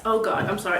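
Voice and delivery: ordinary talk, nothing marked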